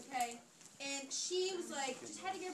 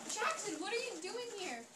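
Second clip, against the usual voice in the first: mildly startled, higher-pitched question; acted surprise; 7 semitones higher than usual. Girl running home finds the new guy in the car, mildly surprised.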